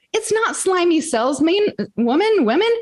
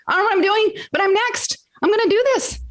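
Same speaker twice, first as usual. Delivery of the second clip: higher, rising with fired-up resolve; determined declaration; 7 semitones higher, faster than usual. Retold or imitated. Retelling how she stood up, excited and determined. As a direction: announce you're next, pumped up.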